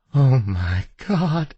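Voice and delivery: slow, high gasped realisation; high, drawn-out disbelief; stunned shock. A narrator's reading. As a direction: draw it out in horrified recognition. Wife shocked at seeing her husband.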